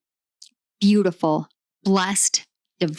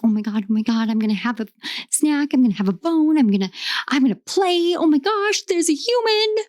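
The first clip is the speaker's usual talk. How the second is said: high, giddy puppy voice climbing higher and higher; excited puppy; 7 semitones higher than usual. Acted. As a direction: gush like a wriggly little puppy, pitch soaring to the end. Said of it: "excited, wriggly little puppy".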